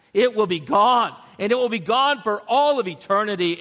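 high, loud, rising preacher's cry; preaching at full force. Acted. Pastor proclaiming victory over death to the church, in full-force preaching. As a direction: proclaim it to the congregation like good news.

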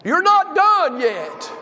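slow, ringing preacher exhortation; preaching, slow and emphatic. Acted. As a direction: plant each word for the seniors. Pastor exhorting seniors.

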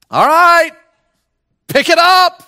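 high, loud call to the crowd; rally them to respond; preacher shouting at the pews. Real, not acted. Urging the congregation on.

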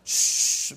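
hushing shh. Retold or imitated. Stewardess hushing screaming girl.

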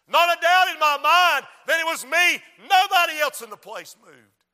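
loud sermon voice, easing off at phrase ends; full-force preaching. Real, not acted. Preacher testifying about his last chance, full-force preaching.

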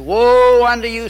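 loud, drawn-out "Woe"; declaimed denunciation. Retold or imitated. Preacher reading Matthew to a radio caller, loudly denouncing. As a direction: cry woe on them like a preacher.

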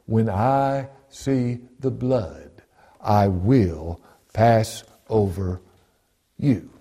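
very slow, word by word; deliberate quote with long pauses; solemn divine pronouncement. Acted. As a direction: weigh out each word of the promise. God's promise quoted as solemn answer.

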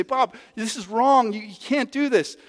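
a light mock plea, drawn out; pleading. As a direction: beg Bob gently to stop. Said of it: "pray with him and plead with him".

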